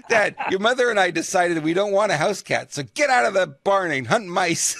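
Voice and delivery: can barely get it out for laughing; cracking up at his own joke; laughing through words. Real, not acted. Teller cracking up mid-joke.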